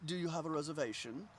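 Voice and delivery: put-on suave French accent, softly spoken; soft, smooth French-accented host; French debonair host. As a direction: play a debonair French maître d'. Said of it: "you know, very French debonair".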